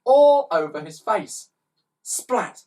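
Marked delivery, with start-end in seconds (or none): 2.0-2.6 splat. A narrator's reading. Sound effect.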